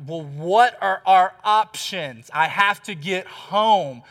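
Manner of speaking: slow plea. Retold or imitated. Pleading with gate agent.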